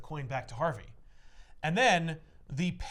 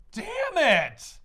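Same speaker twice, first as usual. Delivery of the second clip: high, loud outburst of frustration; retold scream; 12 semitones higher, 9 dB louder than usual. Retelling Bruce's reaction to the cut cable, screaming. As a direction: cry out in anger as the character. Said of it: "he, like, screams at Selina".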